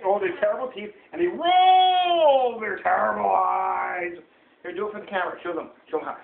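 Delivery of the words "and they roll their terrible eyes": "roll" stretched long and strong; drawn-out dramatic reading. A narrator's reading. Parent reading monsters to a child, "roll" dramatically stretched. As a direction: draw out the word "roll" slowly.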